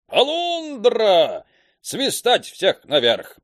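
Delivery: high, loud, slow sailor's bellow; commanding bark. Acted. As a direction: bark the order like an old boatswain. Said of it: "гаркнул командирским голосом". (командирский рявк)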